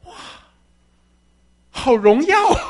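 loud cry of wonder with a laugh; the thief overjoyed at paradise; ecstatic exclaim. Acted. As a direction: burst out at the glory. Thief marvels at the glory.

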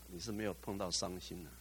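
normal talking voice

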